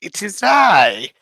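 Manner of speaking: high, slow, grand wizardly announcement; magical Bordy voice. Acted. Player introducing the recap as Bordy, high and slow. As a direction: present yourself as a theatrical magical being. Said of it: "I am doing the wrong accent".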